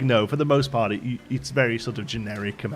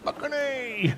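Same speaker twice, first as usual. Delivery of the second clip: high, lightly voiced Canadian drawl; Canadian staff member answering guests, Canadian accent; staff's Canadian accent; 13 semitones higher, 3 dB quieter, much slower than usual. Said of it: "all the staff are like".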